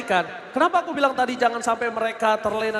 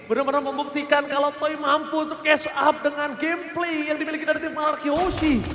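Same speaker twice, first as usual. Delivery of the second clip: ordinary delivery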